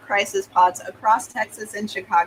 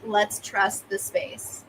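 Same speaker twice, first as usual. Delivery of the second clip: calm reply; 5 dB quieter, slower than usual. Acted. Calm new part answering panic.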